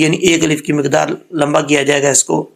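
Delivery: normal talking voice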